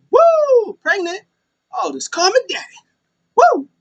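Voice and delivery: very high, loud strutting whoops; Ric Flair impression. Ric Flair bragging about the pregnancy, very high and loud. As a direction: ham up a boastful old wrestler. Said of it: "Ric Flair was like".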